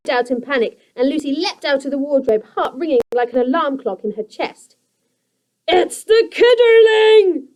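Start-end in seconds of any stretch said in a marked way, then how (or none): 5.7-7.5 very high, slow, loud cry; a goblin spotting the girl; croaking creaker. A narrator's reading. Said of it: "Grunt croaked".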